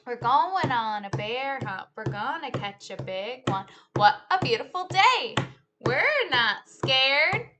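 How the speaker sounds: lively, sing-song storytime read; animated picture-book telling; reading to children. Acted. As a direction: act out the story for a class of kids. Teacher retells picture book with moves.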